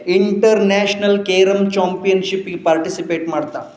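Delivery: rousing sermon climax; triumphant preaching. Acted. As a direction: announce the victory to the crowd.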